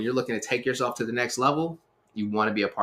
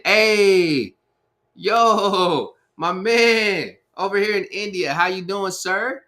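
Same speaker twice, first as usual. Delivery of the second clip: higher, loud, bright-eyed hello to a viewer; excited greeting; 9 semitones higher, 5 dB louder, much slower than usual. Real, not acted. Host hails a pastor joining the live stream, excited. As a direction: call out happily to a friend who just joined.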